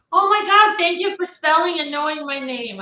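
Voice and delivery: high, loud burst of delight at being recognised; delighted surprise. Real, not acted. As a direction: gush with real joy that someone knew her name. Delighted that a viewer knew her name.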